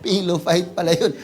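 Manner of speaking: ordinary talk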